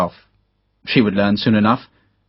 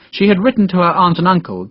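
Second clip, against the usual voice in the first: plain reading voice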